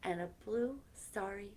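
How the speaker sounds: gentle storybook reading with soft whispered lines; reading to children. Acted. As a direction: read it like a bedtime story to kids. Reading a picture book aloud.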